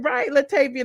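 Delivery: ordinary talk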